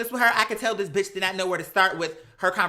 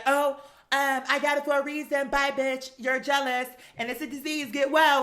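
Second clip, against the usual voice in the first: higher, prissy mocking voice; mock catty one-liners; 6 semitones higher than usual. Mocking Kim's one-liners to the audience, higher and prissy. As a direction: toss off petty comebacks, mocking. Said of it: "she was like".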